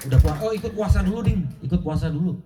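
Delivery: high, loud, fast and eager; brightly announcing good news; excited son. Retold or imitated. Son telling Pak Rudi his mother is fasting, excited.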